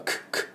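ク as a bare voiceless k burst; English k sound for ク, air only. Shown as a demonstration. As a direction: say ク with no vowel, just the k. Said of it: "シーエイチはク".

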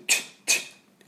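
slow unvoiced kiss-like チッ; blown kiss ch. Shown as a demonstration. Teacher showing the end of beach as a kiss sound. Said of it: "こんな感じ。".

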